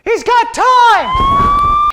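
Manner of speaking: stretched, very high shriek; extremely high drawn-out squeal. Acted. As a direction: squeal it very slow and piercing. Gag punchline.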